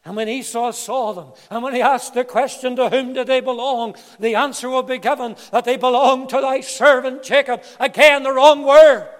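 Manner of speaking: loud, high-pitched pulpit delivery; forceful preaching. Acted. Preacher telling of Esau questioning the servants, building forceful preaching. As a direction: build to a ringing shout.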